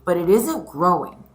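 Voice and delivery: lively read-aloud storytelling; story reading. A narrator's reading. Teacher reading a picture book aloud to her scholars, lively.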